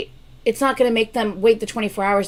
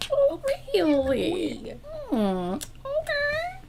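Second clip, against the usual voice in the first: much higher, slow, softer, doubting "okay"; skeptical drawn-out reply; 6 dB quieter, much slower than usual. Retold or imitated. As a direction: act unconvinced, stretching each word. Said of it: "I'm like".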